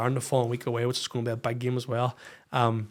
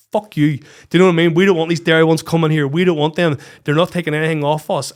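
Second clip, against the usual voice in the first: loud, forceful dismissal; mock hostility; 10 dB louder than usual. Hostile locals to visiting Derry teams, loud and dismissive. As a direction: push it out loudly, acting hostile.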